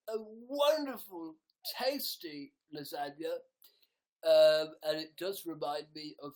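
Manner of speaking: host a pompous food review; food blogger voice. Pretend. Hosting a mock food blog about Oscar films, loud presenter. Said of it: "sounds like an eating blog".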